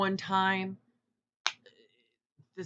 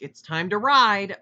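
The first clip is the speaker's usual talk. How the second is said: louder, slow hammed-up declaration; mock loud; 5 semitones higher, 11 dB louder than usual. The imagined milfs pouncing on him, mock loud. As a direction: belt it out like a rallying cry.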